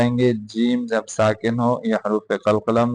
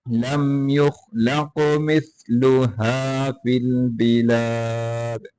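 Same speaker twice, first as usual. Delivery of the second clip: slow chanted tajweed; Quran recitation; much slower than usual. Acted. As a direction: recite the verse melodically. Recites verses melodically.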